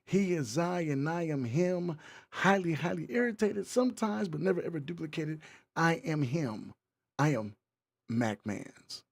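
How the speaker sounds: hype show host. Acted. Host welcoming listeners to the M Spot, hyped MC delivery. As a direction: work the crowd like an MC introducing himself.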